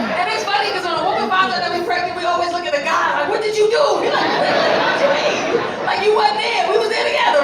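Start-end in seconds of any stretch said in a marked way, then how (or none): 3.3-7.4 mock outraged. Woman and guy after pregnancy news.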